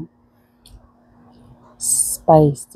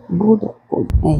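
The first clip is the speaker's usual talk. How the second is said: ordinary talk, nothing marked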